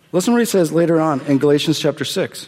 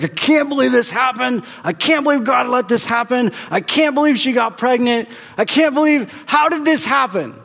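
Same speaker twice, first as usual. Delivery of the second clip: mock angry outburst; 8 semitones higher than usual. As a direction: play the upset guy shouting at God, hammed-up. Angry man retold in pastor's office.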